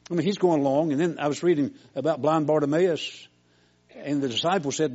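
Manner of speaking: loud, high preaching build; fiery preaching. Acted. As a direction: preach it out to the congregation, full voice. Preaching at full force.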